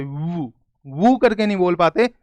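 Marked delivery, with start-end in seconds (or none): 0.0-0.5 वू said soft and low, lips rounded into w; w sound demo. Shown as a demonstration. Teacher demonstrating the w sound for class, soft and low. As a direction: breathe out a quiet rounded वू. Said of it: "डब्ल्यू को ऐसे नहीं बोल पाते".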